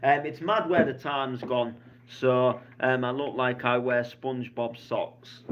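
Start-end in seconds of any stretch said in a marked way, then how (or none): none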